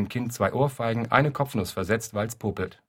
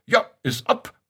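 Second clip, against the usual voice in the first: put-on clipped old man's cry, second much higher; toothless old man; 8 semitones higher, 3 dB louder than usual. Toothless old man passing the window, clipped high cry. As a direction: snap it out, climbing way up. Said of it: "bringt zackig hervor".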